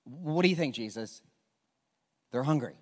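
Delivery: ordinary talk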